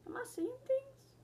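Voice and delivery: high, soft bewildered self-quote; confused self-quote. Retold or imitated. Waking up to the crooked thing, high bewildered murmur. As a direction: say it baffled and airy. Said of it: "I was just like".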